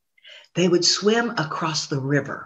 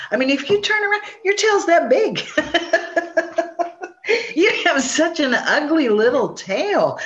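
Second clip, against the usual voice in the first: mocking, laughing; 8 semitones higher than usual. Possum mocking rabbit's tail.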